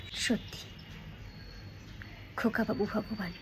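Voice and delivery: hushed, with a long pause after the first word; personal statement. Shown as a demonstration. Teacher shows her own statement to students, hushed and deliberate. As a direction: say it softly as your own belief, unhurried. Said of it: "আমি statement দিলাম".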